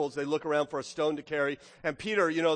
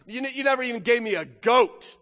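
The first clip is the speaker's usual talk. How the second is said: higher, louder acted accusation; furious older brother; 4 semitones higher, 5 dB louder than usual. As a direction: throw the grievance at your father. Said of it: "the older brother is furious".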